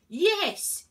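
shouting. A narrator's reading. Inspector shouts yes at Virgo, loud. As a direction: blow up at her. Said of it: "he shouted more loudly".

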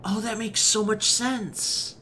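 high, loud, drawn-out "oh" of revelation; real burst of surprised understanding; delighted realization. Real, not acted. Streamer finally getting the French explanation, loud delighted realization.

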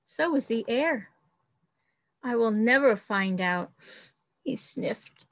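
expressive storybook read-aloud; reading to children. Acted. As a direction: read it like a picture book for the class. Teacher reads picture book aloud.

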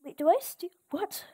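usual speaking voice

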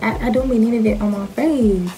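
usual speaking voice

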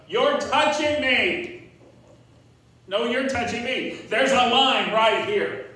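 put-on loud, high, slow kid whine; whiny tattling little sibling. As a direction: bicker like a kid in the back seat. Acted kids fighting in back seat.

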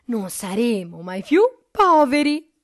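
loud, high, delighted outburst; joyful exclamation. A narrator's reading. Mother sees the golden-egg hen, loud joyful exclamation. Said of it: "esclamò".

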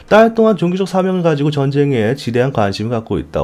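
usual speaking voice

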